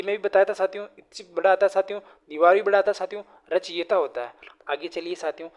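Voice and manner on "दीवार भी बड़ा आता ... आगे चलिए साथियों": ordinary talk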